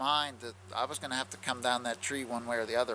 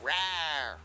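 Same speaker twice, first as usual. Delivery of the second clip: put-on high rawr to startle a child; mock monster growl at a kid; playful growl; 4 semitones higher, 3 dB louder than usual. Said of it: "Just like when you would try to scare a little kid".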